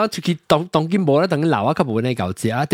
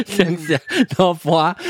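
slow words through a laugh; amused, drawn-out laughing delivery; slower than usual. Real, not acted. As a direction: chuckle as you stretch it out. Laughs while recounting own big meals.

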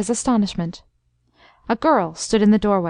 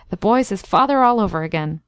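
ordinary delivery, nothing marked

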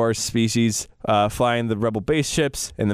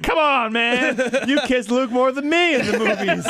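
much higher, whining complaint; a jealous boyfriend pleading, pitched way up; whiny jealous Han; 9 semitones higher than usual. Pretend.

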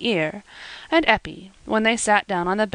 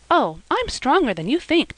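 a hint of a spirited girl, higher; eager girl; 7 semitones higher than usual. A narrator's reading. Eppie protesting to Silas, lightly raised playful girl voice. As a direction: playfully insist on her strength.

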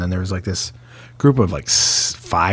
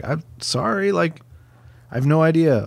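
sheepish apology; 8 semitones higher than usual. Retold or imitated. Apologizing to the course marshal.

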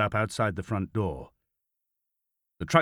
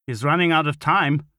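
menacing warning; 6 semitones higher, 6 dB louder than usual. A narrator's reading. Officer threatens over missing son.

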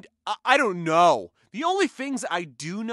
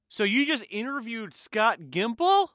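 usual speaking voice

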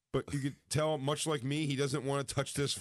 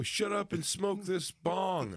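rowdy heckler; 8 semitones higher, slower than usual. Retold or imitated. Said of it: "they go".

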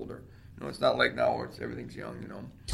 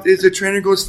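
usual speaking voice

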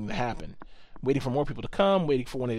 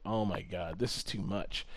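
muttered dismay; 9 semitones lower, 12 dB quieter than usual. Retold or imitated. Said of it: "And I was like".

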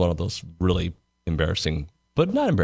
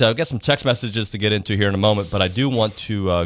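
normal talking voice

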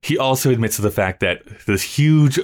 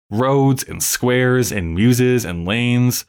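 "roads and squares and muses and lanes" slowly listed, each name lifting upward; upward inflection; 3 semitones higher, much slower than usual. Shown as a demonstration. Host listing rejected street names, "roads and squares and muses and lanes" with upward inflection. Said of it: "always with this upward inflection".

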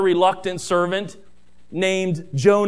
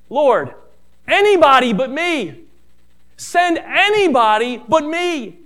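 pleading Moses; 7 semitones higher, 5 dB louder than usual. Acted.

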